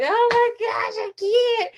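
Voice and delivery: high, strained oh my gosh; emotional cry of overwhelm. Retold or imitated. Retelling breaking down in the car, high and emotional. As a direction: voice it like you're about to break down. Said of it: "I get emotional and I'm just like".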